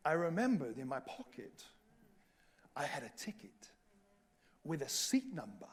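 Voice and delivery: hushed whisper of a secret. Real, not acted. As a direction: whisper it like a hidden card. Secret trump card revealed.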